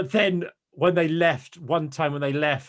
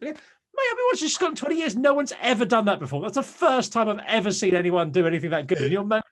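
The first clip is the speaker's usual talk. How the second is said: high, incredulous retort; incredulous rant; 6 semitones higher than usual. Retold or imitated. Fan ranting back at the moaning family, incredulous and high. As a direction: tell the moaner he's mad.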